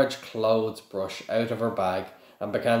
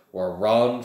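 ordinary delivery, nothing marked

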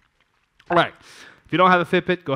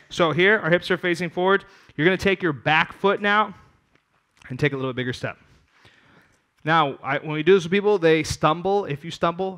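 ordinary talk, nothing marked

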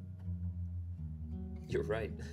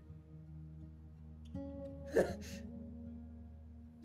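laughing sob; 6 dB quieter than usual. Acted. Laughter turning to crying.

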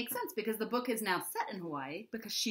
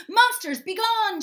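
high, loud battle cry; a kid hero shouting at imaginary monsters; shouted; 12 dB louder, slower than usual. A narrator's reading. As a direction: yell it to scare off monsters. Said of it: "shouted Charlie".

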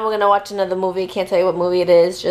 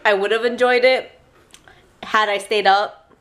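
ordinary delivery

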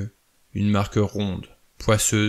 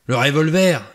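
loud, high exclamation; alarmed burst, pitch leaping up; crying out; 6 semitones higher, 7 dB louder than usual. A narrator's reading. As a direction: shout it in sudden realisation. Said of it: "s'écria Blore".

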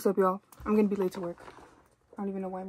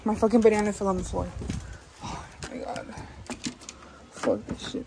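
ordinary talk, nothing marked

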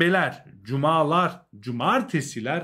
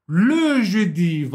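le jeudi said slowly, le stretched and loud; stress on le; 5 semitones higher, 7 dB louder, much slower than usual. Shown as a demonstration. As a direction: lean hard on le in le jeudi. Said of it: "buradaki le yerine le olsaydı".

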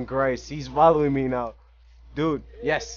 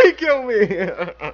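slow laughing cry of defeat; laughing through the words; laughing disbelief; 5 semitones higher, 3 dB louder, slower than usual. Real, not acted. Laughing after death.

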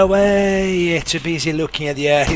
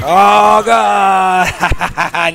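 surprised shout; 3 semitones higher, 4 dB louder than usual. Real, not acted. Near double kill.

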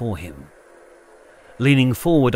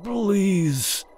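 delirious pleading; 9 semitones higher than usual. A narrator's reading. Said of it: "he was mumbling, delirious".

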